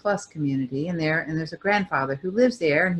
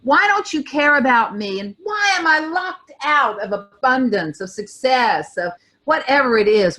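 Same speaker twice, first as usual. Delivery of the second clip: high, aggrieved complaint, fist shaken at the sky; railing at the universe; 7 semitones higher than usual. Retold or imitated. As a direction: accuse life in a higher, wounded voice. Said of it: "shaking my fist at source and saying".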